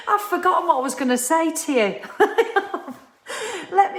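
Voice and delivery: high-pitched, breaking into real laughter; amused disbelief at your own blank; laughing. Real, not acted. Laughs at forgetting her point.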